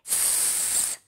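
soft unvoiced hiss; sneaky snake hiss. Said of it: "said Triangle".

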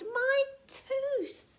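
high wail; pained, drawn-out high cry; cried in pain. A narrator's reading. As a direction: wail over a hurt tooth. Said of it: "he cried".

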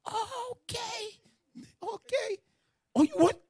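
very high, exaggerated squeals; mock emotional reaction. Preacher mimics a listener swept up in emotion, mock squealing. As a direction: overreact in a squeaky pitch. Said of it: "with a emotion".

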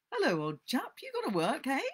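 high, loud jaunty greeting; teasing boy. Acted. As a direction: rib your friend for having to work. Ben taunts working Tom.

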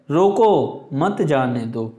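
slow रोको, a clear pause, then मत जाने दो; stress on रोको. Shown as a demonstration. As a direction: set रोको apart as a command. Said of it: "रोको को हम जोर से बोलेंगे".

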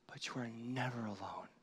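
soft, breathy reassurance; whispered comfort. Real, not acted. Pastor assures singles they are never alone, whispering. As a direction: whisper it like a secret promise.

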